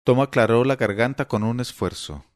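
usual speaking voice

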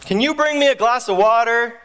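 high, called-out request; son yelling out. Acted. Son asking dad for water, yelling. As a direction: call from bed to another room. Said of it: "the son yells out".

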